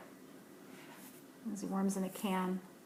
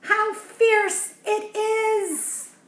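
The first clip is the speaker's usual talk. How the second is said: much higher, louder, very slow strained cry; fierce cry; 12 semitones higher, 9 dB louder, much slower than usual. Acted. Professor Warmbug struggling with a huge fish, high, slow strained cry. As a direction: cry out, straining against the line.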